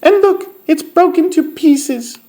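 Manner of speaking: very high, tiny cub voice, upset; squeaky Baby Bear. A narrator's reading. Baby Bear finds his chair broken, tiny squeak. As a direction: squeal it like a dismayed little bear. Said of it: "squeaked Baby Bear in his tiny little voice".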